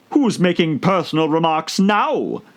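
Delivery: high, gloating Hatter voice; a triumphant little dig. A narrator's reading. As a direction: crow it as a win over Alice. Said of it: "the Hatter asked triumphantly".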